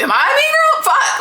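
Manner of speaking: high-pitched squeal of disbelief; squeaky, astonished question; incredulous. Real, not acted. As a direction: shoot way up high, baffled at yourself. Mock self-doubt, breaking into laughter.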